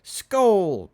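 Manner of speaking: high, loud drinking cheers; barked toasts. Acted. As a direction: bark out each toast like a chorus. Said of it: "barked the chorus".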